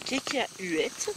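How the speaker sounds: cacahuètes stretched very slowly; drawn out. Shown as a demonstration. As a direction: drag out cacahuètes playfully. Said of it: "cacahuètes repeated".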